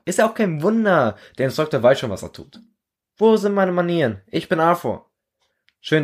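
an excited recruit glad to meet a friend; eager young soldier Arthur. Acted. Arthur greeting the new recruit, high and eager.

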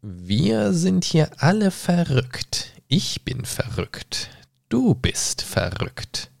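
high, slow, sly cat voice sinking to a whisper; drawn-out grinning cat, fading off; Cheshire cat voice. Acted. Cat tells Alice everyone here is mad, slow and eerie. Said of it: "antwortet die Katze".

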